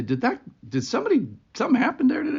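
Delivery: mild mock curiosity of a stranger; curious asking. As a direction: ask like a puzzled bystander. Asking store staff in surprise.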